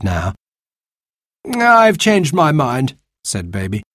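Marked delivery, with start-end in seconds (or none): 1.5-3.0 higher, slow offhand voice; airy young man. Acted. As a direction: toss off a whim. Baby decides.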